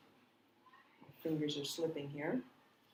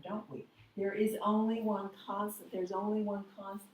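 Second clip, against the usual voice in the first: ordinary delivery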